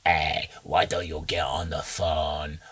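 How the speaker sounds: slow, low creepy whisper-ish voice; a hint of a creepy internet ghoul; Momo voice. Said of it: "I don't think she talks like that".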